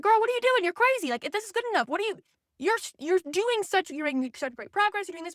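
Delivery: mock girlfriend pep talk; hyped-up friend. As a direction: gush like a supportive bestie. Encouraging a friend, voiced quote.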